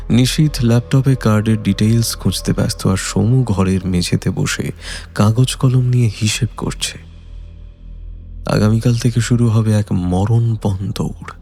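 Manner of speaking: usual speaking voice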